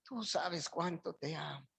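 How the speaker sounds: hushed, near-whispered tearful reply. Retold or imitated. Pedro answering the Lord, hushed and tearful. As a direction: barely voice it, like through tears. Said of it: "con lágrimas en los ojos".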